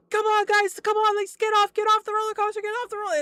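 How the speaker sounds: high, loud, insistent urging; urgent attendant. Retold or imitated. Retold attendant hurrying riders off a roller coaster, urgent shouting.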